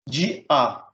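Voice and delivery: "De A" as two separate syllables; split hiatus. Shown as a demonstration. Teacher showing a hiatus, "De A" in two beats. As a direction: break cleanly between "De" and "A". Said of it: "devem ser separados em duas sílabas".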